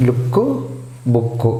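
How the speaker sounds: లుక్, బుక్ each with a short oo; short vowels. Shown as a demonstration. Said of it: "లుక్ short ప్రొనౌన్సియేషనే". (లుక్, బుక్ లో పొట్టి ఊ శబ్దం)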